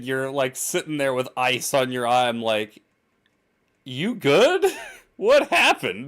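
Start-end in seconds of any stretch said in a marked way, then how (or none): 3.8-6.1 higher, startled check-in with a laugh between; a coworker finds his friend iced up, taken aback; surprised concern. Retold or imitated. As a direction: ask it like you just walked in on a mess. Walks in on injured coworker.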